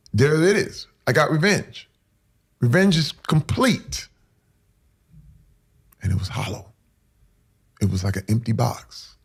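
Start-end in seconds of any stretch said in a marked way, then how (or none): 0.1-4.1 high-pitched proclamation of victory; mock triumph. Man retelling his imagined victory over his father, mock triumphant. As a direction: declare it like a grand win, pitched up.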